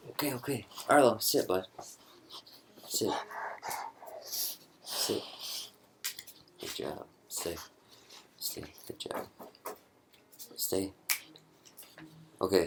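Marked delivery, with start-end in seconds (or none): 0.1-10.9 soft, brief pet commands; settling a dog. Real, not acted. Owner settles his dog mid-video, hushed commands. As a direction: quietly coax and praise a dog.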